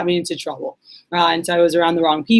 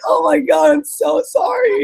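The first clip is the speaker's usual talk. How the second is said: much higher, louder, slow hammed-up sorry; mock horrified apology; 11 semitones higher, 4 dB louder, slower than usual. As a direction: overdo the shocked apology to her sister. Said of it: "I'm like".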